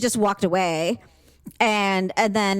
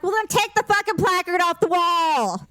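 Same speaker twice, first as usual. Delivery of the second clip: angry shout; 8 semitones higher than usual. Retold or imitated. Retelling to a friend how she yelled at the cashier, angry shout. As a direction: yell it at the cashier, fed up. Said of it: "I'm like".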